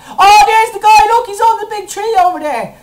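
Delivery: high, loud cry of discovery; real thrilled outburst; excited shout. Real, not acted. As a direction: yell it out as you spot him. Spots the boss.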